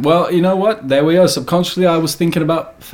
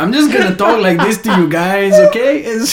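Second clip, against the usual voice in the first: hammed-up Latin American accent; chatty mock Latin American accent, lifting on the check-in; 2 semitones higher than usual. Shown as a demonstration. Podcaster demonstrating for his co-hosts, comic Latin American accent. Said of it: "this is my Latin American accent".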